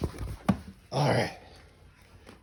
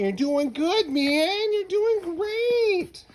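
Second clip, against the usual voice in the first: much higher, louder, drawn-out praise; excited cheering; 4 dB louder, much slower than usual. Real, not acted. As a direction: cheer him on, stretching great. Cheering toddler's walking.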